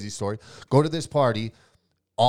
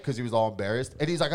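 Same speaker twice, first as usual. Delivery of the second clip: ordinary talk, nothing marked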